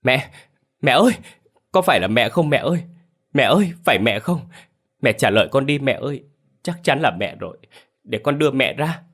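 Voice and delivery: high, urgent cries to mother; acted frantic calling from a distraught daughter. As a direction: call out desperately, pitched well up. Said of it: "chị lao về phía quan tài mà gọi lớn".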